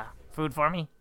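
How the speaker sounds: low, gruff little roach voice; cockroach voice. Pretend. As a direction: voice a cockroach begging, pitched low. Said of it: "That's how roaches talk".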